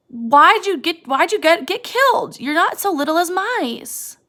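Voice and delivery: high, pained lament to the dead puppy; Lenny cries out to the puppy he killed; grieving Lenny. Acted. A high grieving cry over the dead puppy. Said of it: "And he's very sad".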